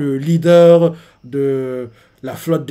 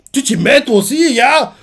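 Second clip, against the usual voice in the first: much higher, louder mock astonishment; surprised Aludine; 10 semitones higher, 6 dB louder than usual. Aludine reacts to Scopper siding with Luffy, high startled yelp. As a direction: yelp it in disbelief. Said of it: "surpris, fait".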